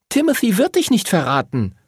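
put-on high, certain girl's voice; firm. A narrator's reading. Lucy contradicts Henry about Timothy, high and firm. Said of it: "sagte Lucy bestimmt".

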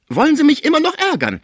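high, loud, indignant question; angry rebuke. A narrator's reading. Caretaker fed up with her patient's questions, angry rebuke. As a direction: snap back with the pitch way up.

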